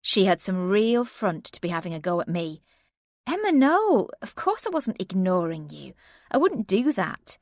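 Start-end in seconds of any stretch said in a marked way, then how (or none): 3.3-7.4 higher, pleading no; insist, a little hurt; earnest denial. Acted. Narrator denies to friend.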